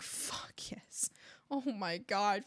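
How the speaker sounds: breathless whisper; whispered awe. Retold or imitated. Streamer acting her whispered reaction to the podcast, hushed awe. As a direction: whisper it like a secret treasure. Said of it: "I'm like".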